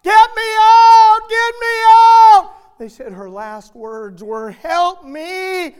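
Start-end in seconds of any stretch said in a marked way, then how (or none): 0.0-2.5 slow, piercing, much higher and louder screams; retold scream. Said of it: "She's screaming". 4.5-5.8 higher and louder, a cry for help; desperate cry. Retold or imitated. Girl's last words in fire.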